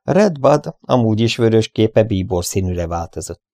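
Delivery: plain reading voice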